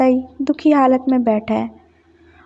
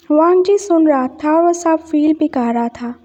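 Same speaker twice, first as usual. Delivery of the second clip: usual speaking voice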